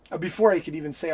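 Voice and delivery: ordinary talk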